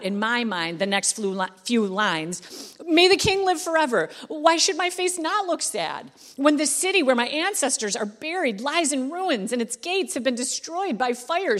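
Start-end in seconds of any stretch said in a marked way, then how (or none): 2.8-11.6 higher, louder, words tumbling out in a rush; emotional blurt. Retold or imitated. Cupbearer blurting his grief to the king, emotional rush. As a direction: blurt it out all at once, pleading. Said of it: "he kind of blurts out".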